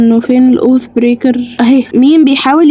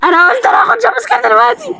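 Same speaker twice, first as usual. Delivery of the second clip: frustrated repeat, pitched way up; exasperated shout; 11 semitones higher, faster than usual. Real, not acted. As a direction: yell it again because nobody believes you. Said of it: "ومش بتصدقوني".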